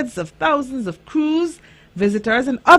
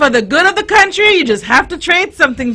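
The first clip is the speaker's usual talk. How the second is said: emphatic echo; 8 semitones higher, 8 dB louder, faster than usual. Acted. Host echoes child's phrase with gusto.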